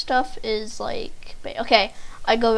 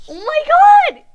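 very high, loud outburst; exasperated scream; 14 dB louder than usual. Real, not acted. Player after losing yet another try, exasperated scream. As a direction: scream it in frustration.

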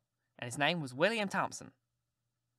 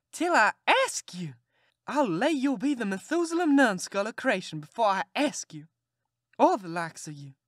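a proud aunt swearing she'll never ask; furious aunt; 5 semitones higher, 5 dB louder than usual. Acted. Aunt outraged at the boy's cheek, high and loud.

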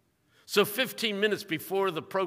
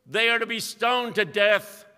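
ordinary talk

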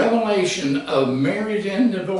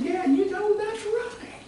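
much higher, softer put-on voice of an agreeing friend; mock friend; 6 dB quieter than usual. Preacher mocking the wife's friend with a high, soft put-on voice. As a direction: squeak out agreement like a gullible friend. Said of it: "And the friend said".

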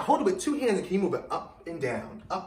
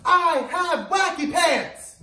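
loud slow shout; louder; 13 semitones higher, 7 dB louder, much slower than usual. Shown as a demonstration.

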